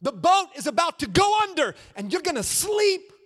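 full-force sermon shout; shouted preaching. Acted. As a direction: hurl the storm at the crowd. Preacher shouting at sleeping Jesus.

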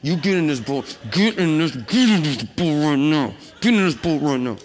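stern grandma ordering them into the boat, slowing and pressing on the end; a firm Big Mama putting her foot down; mock grandma command, every get hit hard; Big Mama scolding. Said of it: "he went Big Mama on".

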